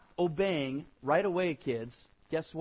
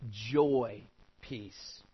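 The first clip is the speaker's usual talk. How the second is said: ordinary delivery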